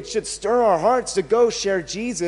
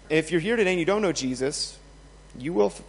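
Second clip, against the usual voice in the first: normal talking voice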